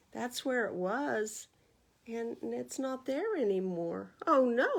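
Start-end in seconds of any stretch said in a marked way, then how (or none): 4.2-4.8 loud dismayed "oh no"; a pained moan of dismay. Acted. Rabbit moans finding his pot gone, loud dismay. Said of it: "he moaned".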